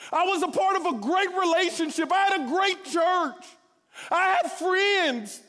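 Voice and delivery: loud, high, pleading complaint, voice straining; anguished cry to God. Retold or imitated. To God after a year of bus driving, a strained shouted complaint. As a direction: pour out the frustration at God, nearly shouting.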